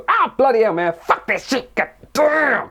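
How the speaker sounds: high, hammed-up yelp of pain and swearing; mock pained cursing. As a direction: curse as if you just hit your finger. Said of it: "We, we hit our finger".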